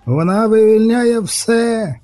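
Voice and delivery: very high, long-drawn cry; wailing storyteller's shout of alarm; panicked shout. A narrator's reading. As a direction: stretch every word on a high, held pitch. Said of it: "крикнув мишавур".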